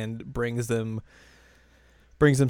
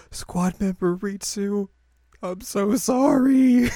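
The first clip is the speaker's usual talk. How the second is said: high, slow, hammed-up sorrow; mock solemn apology; 9 semitones higher, 5 dB louder, slower than usual. Quoting Ritsu apologising to Yui, high and slow mock-solemn. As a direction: drag it out like a tragic farewell. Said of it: "Ritsu's like".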